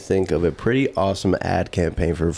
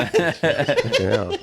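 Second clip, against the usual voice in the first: laughing; 3 semitones higher, much slower than usual. Real, not acted. Laughing at the curse.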